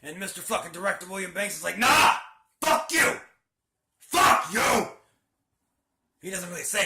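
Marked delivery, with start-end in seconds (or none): 1.8-4.9 loud, defiant yelled retort; cocky outburst. Retold or imitated. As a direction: hurl it at the Marine like a cocky boss. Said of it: "he's cocky, he's smart ass".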